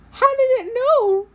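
high, slow shocked wail. Real, not acted. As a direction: wail it in disbelief. Caught by murderer.

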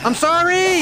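high, loud yelled sorry; shouted apology. Real, not acted. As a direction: blurt the apology out in a yell. Shouting apology during a game.

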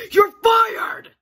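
high, loud firing shout; furious yell. Acted. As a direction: bark it at the idiots. Boss yelling at employees.

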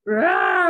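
high, loud rough growl; bear growl. A narrator's reading. Old Bear answers Baba Brana's question with a loud growl. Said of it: "Old Bear growled".

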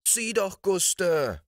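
put-on high, excited cry of kids; children call out to Guste, high and eager; children shouting. A narrator's reading. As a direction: call Guste urgently to look. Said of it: "schrien die Kinder".

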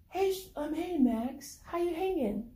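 mom's voice. Acted. Mom voiced on phone.

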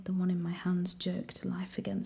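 usual speaking voice

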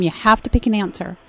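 ordinary talk

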